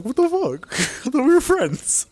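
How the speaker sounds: high, put-on offended voice; hammed-up wounded friend; mock hurt indignation. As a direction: protest the snub in a squeaky high pitch. Acting out snubbed husband's reaction.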